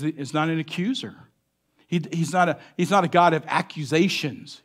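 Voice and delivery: ordinary talk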